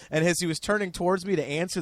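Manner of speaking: normal talking voice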